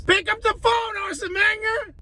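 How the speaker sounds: high-pitched exasperated demand; high, worked-up appeal; exasperated plea. Real, not acted. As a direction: pitch it way up, pleading in frustration. Calling on Wenger to act.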